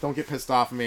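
normal talking voice

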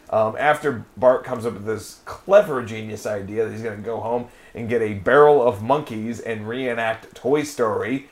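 ordinary talk, nothing marked

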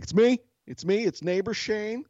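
high-pitched announcing call; retold calling out. Neighbor with his hand out, high retold call.

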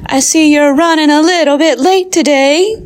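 a bright nosy coworker catching someone late; high, sweet teacher voice; chirpy colleague. Acted.

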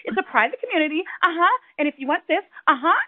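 high, beaming sales voice; overly smiley agent. Retold or imitated. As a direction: gush like a too-cheery agent. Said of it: "she's like".

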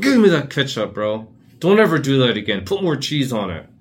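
loud high scolding; snatch the ketchup and scold; bossy kid scolding. Retold or imitated. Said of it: "It's like, reach out".

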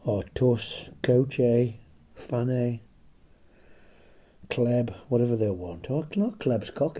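ordinary talk, nothing marked

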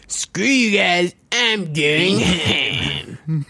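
very slow, high Cartman whine; Cartman impression. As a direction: stretch every word like Cartman. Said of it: "He always does the".

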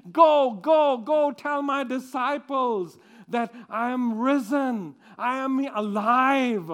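loud, joyful sending; urgent command. Acted. Jesus sending Mary to the disciples, loud and urgent. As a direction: urge her onward with joy.

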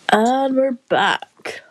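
slow, loud announcer's return; hammed-up show comeback; mock presenter intro. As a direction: draw it out like a radio host. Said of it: "announcing recording resumed".

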